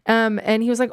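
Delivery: ordinary talk, nothing marked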